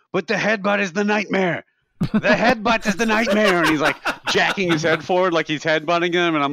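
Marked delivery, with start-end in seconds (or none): 0.1-1.6 raised, higher voice, imitating a ranting cabbie; building a mock rant about the headbutt; mock cabbie yell. Retelling the cab driver ranting to passengers, mock yell. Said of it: "just screaming". 2.1-3.6 much higher and louder, laughing through it; hammed-up repeat of the rant, pushed harder; louder mock cabbie yell. Retold cabbie yelling at passengers, louder laughing yell. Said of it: "just screaming".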